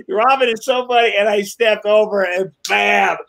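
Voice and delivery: usual speaking voice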